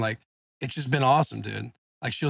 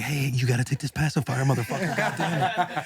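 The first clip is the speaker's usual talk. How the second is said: put-on jokey exasperated dad at a crying baby; mock scolding.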